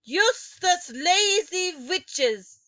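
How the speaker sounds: very loud, high, slow witch tirade; Grand High Witch berating the witches; furious witch rant. A narrator's reading. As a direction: rage at them like a witch queen.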